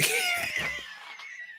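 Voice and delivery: high laughing shriek. Real, not acted. Laughs at imagined selfie.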